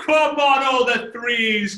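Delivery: loud, high rallying shout; cheering. Real, not acted. Speaker urging the chat to drop threes, cheering. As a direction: holler it out to rally a crowd.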